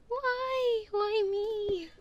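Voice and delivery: high, loud wail of mock despair; hammed-up lament. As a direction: wail to the heavens over a pumpkin.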